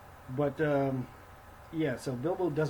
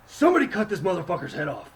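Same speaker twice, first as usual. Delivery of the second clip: angry order; 5 semitones higher, 7 dB louder than usual. Retold or imitated. Thorin ordering execution.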